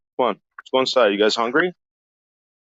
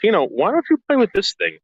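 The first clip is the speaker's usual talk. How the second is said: dog talk; 10 semitones higher than usual. Real, not acted. Coaxing dog to play.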